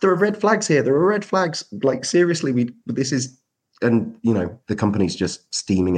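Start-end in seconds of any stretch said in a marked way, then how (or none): 0.0-3.4 much higher, urgent mock alarm; alarmed warning. Marketing person warning the company, in high urgent alarm. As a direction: sound the alarm about red flags. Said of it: "I'm going".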